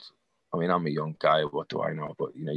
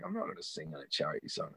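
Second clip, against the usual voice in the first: low and quiet; 5 semitones lower, 13 dB quieter than usual. Retold or imitated. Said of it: "He's like".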